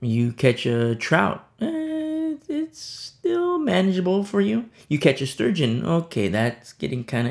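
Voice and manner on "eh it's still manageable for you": shrug. Acted. Shrugging about catching trout.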